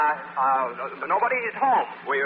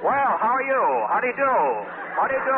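overeager greeting; 11 semitones higher than usual. Acted. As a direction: greet him far too heartily. Overdone greeting of the lieutenant.

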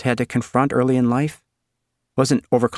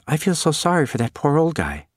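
put-on high girl's voice; 7 semitones higher than usual. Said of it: "Suzanne said".

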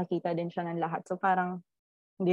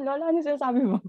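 incredulous; 6 semitones higher, 3 dB louder, much faster than usual. Retold or imitated. Questioning grandmother in disbelief.

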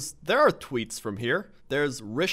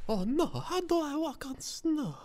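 mock helpless voice; 12 semitones higher, 6 dB quieter than usual. Voicing confused tweeter.

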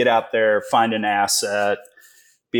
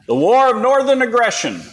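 mock Southern outburst; 14 semitones higher, 5 dB louder than usual. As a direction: holler it as a joke Southern diehard. Mock Southern retort, then "just kidding.

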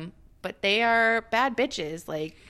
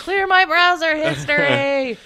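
high, slow and loud, mock solemn; hammed-up dying plea; 9 semitones higher, 9 dB louder than usual. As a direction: deliver a last wish like a grand tragedy. Joke parody of dying plea.

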